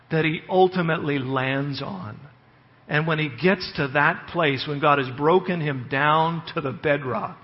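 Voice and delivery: normal talking voice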